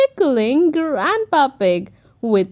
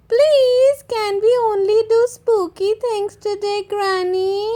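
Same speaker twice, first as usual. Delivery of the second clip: put-on high, eager little piglet girl; little Peppa voice; 7 semitones higher than usual. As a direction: ask sweetly like a small child. Peppa's voice in story.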